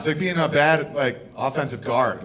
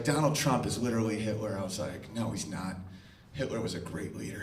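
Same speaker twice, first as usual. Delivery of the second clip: muttered quote; 7 semitones lower, 11 dB quieter than usual. Retold or imitated. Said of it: "someone recently was like".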